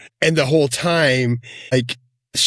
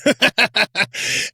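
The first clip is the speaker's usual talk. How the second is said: high laugh; 10 semitones higher than usual. Real, not acted. Laughing at the kill line.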